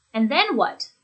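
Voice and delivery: "And then what" peaking, then dropping at the end; eager prompt with a downward finish on "And then what"; falling intonation. Shown as a demonstration. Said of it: "with that falling intonation".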